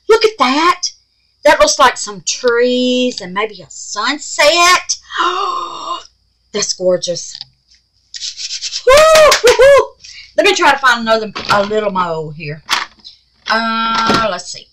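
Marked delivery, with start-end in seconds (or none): 5.0-6.0 high, drawn gasp of amazement; delighted gasp. Real, not acted. Delighted at the colours. 8.8-9.9 very high, loud woos; excited whooping. Real, not acted. Cheering her result.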